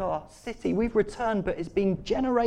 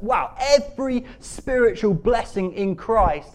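slow, loud, higher marvelling; awestruck emphasis; 4 semitones higher, 7 dB louder, slower than usual. Real, not acted. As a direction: savour each word in loud amazement. Marvels at the verse just read.

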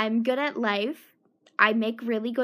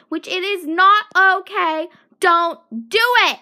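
loud, higher scolding shout; angry shout; 5 semitones higher, 10 dB louder than usual. Real, not acted. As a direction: bark it as a warning. Angry rant at men.

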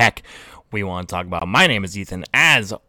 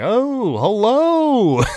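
high mock host greeting; fake game show voice; 13 semitones higher, 5 dB louder, much slower than usual. Brother greeting coworkers in a hammed-up game show voice. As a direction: sing out a cheesy TV host hello. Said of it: "go to work and be like".

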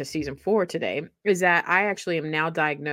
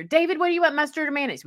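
higher mother calling across the kitchen; 11 semitones higher, faster than usual. Retold or imitated. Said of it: "she'd be calling out our names".

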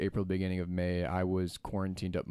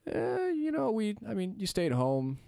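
mock casual voice; 13 semitones higher than usual. Mocking how people describe quarantine.

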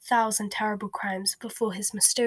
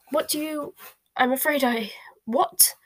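higher, halting bluster; flustered disbelief, pitched up; 5 semitones higher than usual. A narrator's reading. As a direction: bluster in broken fragments, rising on the last. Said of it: "blustered the Prime Minister".

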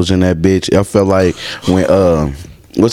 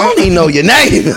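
laughing mock; 9 semitones higher than usual. Mocking the club girl.

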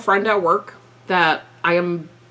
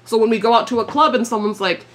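ordinary delivery

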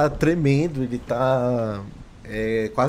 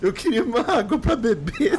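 high-pitched words through laughter; laughing; 9 semitones higher than usual. Real, not acted. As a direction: laugh your way through the request. Player laughing at supositório joke.

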